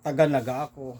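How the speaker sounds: put-on says it with a telltale Bula accent; the Bula accent slips through; Bula-accented Tagalog. Bula passenger betrays his accent to the Naga men. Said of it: "Sa tono mo lang".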